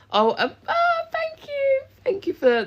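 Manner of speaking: high delighted gasp of thanks; surprised squeal. Real, not acted. Reacting to a gift.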